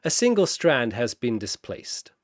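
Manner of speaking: plain reading voice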